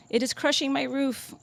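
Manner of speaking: lifted, playful pillow-talk voice. Acted. Lover under the covers in the morning, playful voice. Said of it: "in that playful voice we use under the covers".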